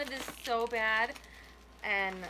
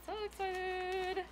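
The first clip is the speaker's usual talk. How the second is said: higher, slow squealing excitement; excited; 9 semitones higher, 4 dB quieter, much slower than usual. Real, not acted. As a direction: stretch it out, thrilled. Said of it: "I'm so excited".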